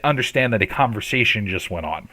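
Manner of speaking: usual speaking voice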